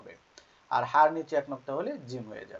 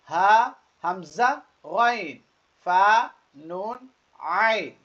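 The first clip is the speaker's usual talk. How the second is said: each letter name higher and drawn out; letter-by-letter recitation; 5 semitones higher, 10 dB louder, much slower than usual. Shown as a demonstration. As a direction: recite slowly for learners to repeat, pausing between letters. Reads letters for learners. (টেনে টেনে হরফ পড়া)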